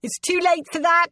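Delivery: high-pitched, fearful protest; alarmed reply. A narrator's reading. Fleeing sculptor about to be killed.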